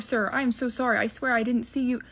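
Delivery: ordinary talk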